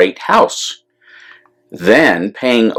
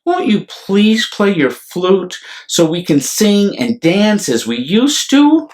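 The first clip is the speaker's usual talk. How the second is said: put-on higher-pitched pleading child's voice; young girl's request, pitched up throughout; youngest daughter; 8 semitones higher than usual. A narrator's reading. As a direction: plead like a little girl asking her father. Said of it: "said the youngest girl".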